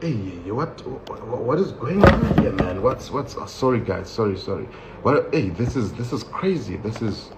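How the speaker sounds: soft, stammering bewilderment; hushed, halting protest to himself; flustered disbelief. Acted. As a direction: mumble in disbelief under your breath. Stunned reaction while being searched.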